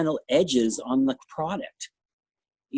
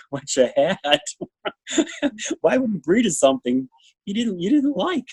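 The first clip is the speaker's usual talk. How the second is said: real laughter breaking into higher-pitched words; laughing incredulously; 6 semitones higher than usual. Real, not acted. Judge scoffing at breeding gossip, laughing incredulously. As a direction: laugh through it, pitch climbing in disbelief.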